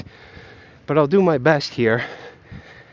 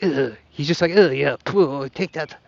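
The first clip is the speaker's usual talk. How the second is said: Adam Back; 2 semitones lower, 3 dB quieter than usual. Acted. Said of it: "how to do an Adam Back impression".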